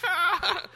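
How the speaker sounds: high, loud mock cry of defeat; laughing "ah" of dismay; mock groan. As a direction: wail at the flop, half laughing. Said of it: "I was like".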